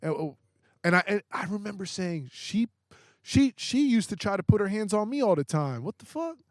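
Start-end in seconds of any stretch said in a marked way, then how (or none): 2.3-6.4 high, loud, indignant protest; angry denial. Retold or imitated. Denying the accusation to the cops, high, loud and outraged. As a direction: insist you never touched her, outraged. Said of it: "I'm so mad".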